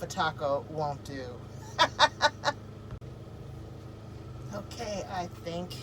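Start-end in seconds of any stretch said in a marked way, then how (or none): 1.3-4.5 breathy giggle. Real, not acted. Giggle after food joke.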